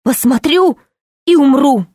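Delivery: quiver, close to tears; trembling voice. A narrator's reading. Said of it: "дрожащим голосом".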